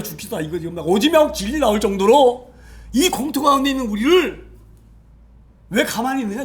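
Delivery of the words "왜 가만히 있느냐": usual speaking voice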